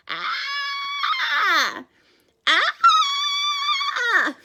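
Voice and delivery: very high, loud scream; performed scream. Shown as a demonstration. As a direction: let out a full horror scream. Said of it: "That was actually pretty good".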